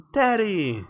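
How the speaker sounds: put-on high little-boy call; young Mikey. Little boy lost in the woods calling his father, high-pitched. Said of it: "says young, young Mikey".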